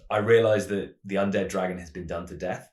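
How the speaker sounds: ordinary talk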